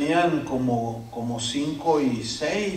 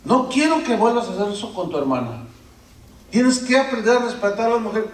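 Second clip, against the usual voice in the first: scolding father; 5 semitones higher than usual. Acted.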